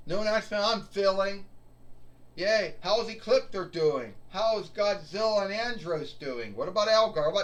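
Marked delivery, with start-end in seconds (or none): none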